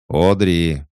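loud call of her name. A narrator's reading. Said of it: "позвал дядя".

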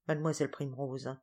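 plain reading voice

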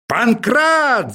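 high, raging call for the servant; furious shout. Acted. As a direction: yell the name in fury. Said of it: "закричал профессор в бешенстве".

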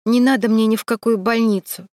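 ordinary delivery

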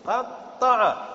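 قطّع said very slowly, the doubled ط held long; drawn-out doubled letter. Shown as a demonstration. Teacher stretching قطّع to show repeated ط. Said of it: "فنكرر الطاء".

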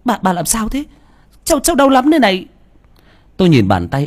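high, fast frightened cry of pain; panting cry. Acted. Frightened grandchild cries out to grandma, high and panicked. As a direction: cry out in panic, short of breath. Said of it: "thở lên hồng hộc mà hét lên".

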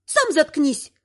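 mild angry snap; curt retort, without shouting; snapping. A narrator's reading. Marina tells the stranger to shut up, snapping. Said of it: "рявкнула Марина".

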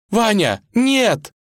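high, loud anguished wail; sobbing cry. Acted. Vasilisa runs to the edge after Ivan falls, sobbing. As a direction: scream it in grief. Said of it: "зарыдала она".

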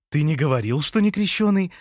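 light up at the good news; delighted surprise. A narrator's reading. Лея learns the neighbour is unbaptised, delighted. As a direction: pitch leaping up with pleased surprise. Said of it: "обрадовалась Лея".

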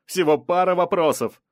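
insistent reporter. A narrator's reading. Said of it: "не сдавался репортёр".

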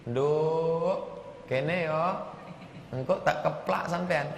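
softly imitating a Javanese mother calling her child; a hint of laughter, sweet and soft; gentle Javanese call. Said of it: "Kan orang Jawa itu lembut".

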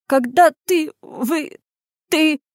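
high, halting, broken-off start; faltering stammer. A narrator's reading. Frightened lad struggles to explain to Anya, stammering. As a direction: falter and restart, nervously. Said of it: "Не мямли!".

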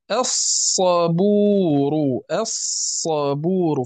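الصبور very drawn out, "as...sabuuru"; silent lam. Shown as a demonstration. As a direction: hold a long hissed doubled S in الصبور. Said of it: "فلا نتلفظ بتلك اللام".

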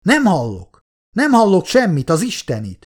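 exasperated, high-pitched calling out; acted yell. A narrator's reading. Quinn cursing a bad radio line, exasperated high yell. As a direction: strain to be understood, pitch jumping up. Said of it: "ordította".

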